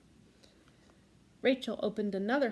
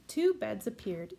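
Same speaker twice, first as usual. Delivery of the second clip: plain reading voice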